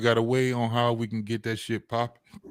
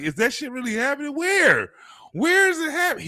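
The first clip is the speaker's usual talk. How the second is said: high, pressing questions; eager disbelief; 11 semitones higher than usual. Retold or imitated. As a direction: push higher on each where. Mock eager listener asking.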